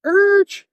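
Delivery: high, loud tire-screech noise; imitated brake screech. Guy slams on the brake, high imitated screech. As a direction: screech like brakes suddenly locking up.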